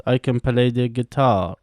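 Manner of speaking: ordinary talk, nothing marked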